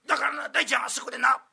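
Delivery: put-on impassioned old master. Master pitching idea passionately.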